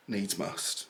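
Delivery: normal talking voice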